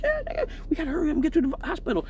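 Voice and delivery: fast, high, cracking with laughter; laughing while quoting. Retold or imitated. Telling the driver to hurry, laughing fast and high. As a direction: rush it out through laughter. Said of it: "I was like".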